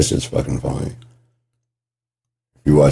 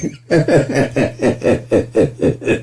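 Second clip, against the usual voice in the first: long high giggle, tailing down; laughter; 6 semitones higher than usual. Real, not acted. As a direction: giggle it out till it fades. Cracking up at the line.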